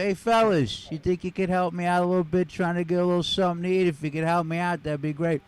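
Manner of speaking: lightly voiced street beggar, pleading; a hint of a panhandler working the crowd; mild put-on beggar voice; beggar asking for money. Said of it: "He said".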